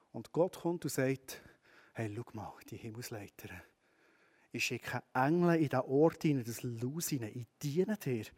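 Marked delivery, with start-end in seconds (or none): 1.9-8.2 much softer and lower, gentle reassurance; God softly reassuring the weary listener; tender voice of God. Acted. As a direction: speak it like a father comforting a child.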